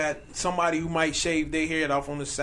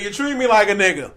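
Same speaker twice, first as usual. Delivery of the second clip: louder, higher mock yell; retold yell; 7 semitones higher, 12 dB louder than usual. Friend imitates the man's imagined line, mock yell. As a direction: belt it out like the arrested man. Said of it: "he once said it".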